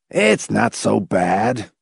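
slow, easygoing put-on voice; philosophical. A narrator's reading. McFife consoling Hamilton, philosophical. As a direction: drag it out, resigned and easy. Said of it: "McFife said philosophically".

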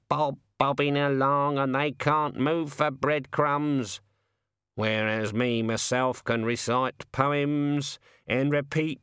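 a put-upon bird having a moan, slowly; raven voice. Acted. The raven grumbles about bird tables.

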